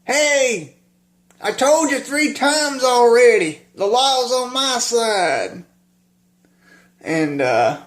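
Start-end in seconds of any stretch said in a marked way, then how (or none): 0.1-5.7 high, drawn-out mock yell; crazy yelling. Retelling Doyle's scene with his band buddies, mock crazy yelling. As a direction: holler it high and slow, without full volume. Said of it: "this man all of a sudden yelling like crazy".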